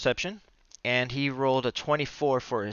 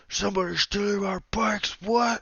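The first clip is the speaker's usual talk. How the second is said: higher, gurgling amphibian voice; a slimy guttural frog-thing talking; gurgling frog-creature voice; 7 semitones higher than usual. Acted. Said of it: "you hear very gurgling".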